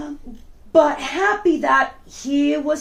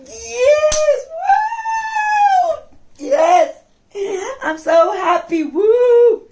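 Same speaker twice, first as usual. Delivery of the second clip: higher and louder, whooping for joy; joyful cheering; 9 semitones higher, 6 dB louder, much slower than usual. Acted. Narrator cheering as the friends escape, loud and joyful. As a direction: cheer like you just escaped the shark.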